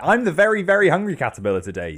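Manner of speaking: loud, high opening burst as the caterpillar; emphatic declaration. Acted. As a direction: announce your new title loudly and quickly. Voicing the proud hungry caterpillar's announcement.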